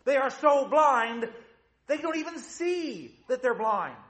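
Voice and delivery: high-pitched, incredulous preaching; impassioned preaching. Acted. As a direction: press the point hard, voice held high. Preaches at full force.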